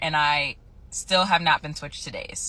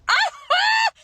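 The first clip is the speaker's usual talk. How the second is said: very high, loud squeal; excited squeal; 6 dB louder than usual. Real, not acted. Nurse celebrating quitting her job, excited squeal. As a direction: let out a piercing shriek of excitement. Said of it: "You sound so excited".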